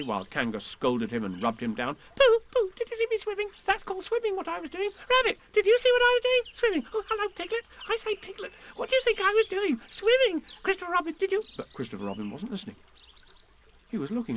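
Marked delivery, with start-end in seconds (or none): 2.1-11.4 very high, gleeful chatter; high-pitched little show-off, bubbling over; excited squeaky creature. A narrator's reading. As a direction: pester everyone for attention in a tiny squeak. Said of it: "squeaked Roo excitedly".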